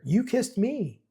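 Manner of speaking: high, stunned accusation; shocked. Acted. Bear to Nikki, stunned that she kissed him. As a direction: say it like you can't believe it.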